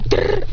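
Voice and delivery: rolled digging sound effect; short trilled "grrr"; digging noise. Acted.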